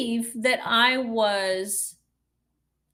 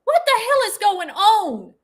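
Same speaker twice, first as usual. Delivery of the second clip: officer's demand; 9 semitones higher, 6 dB louder than usual. Retold or imitated. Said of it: "And the officer said".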